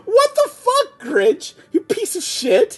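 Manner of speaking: very high, laughing outrage; outraged disbelief. Real, not acted. Reacting to the Grinch slapping Frank, high laughing outrage. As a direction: shriek in amused shock.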